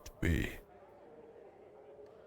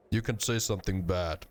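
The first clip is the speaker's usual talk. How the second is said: usual speaking voice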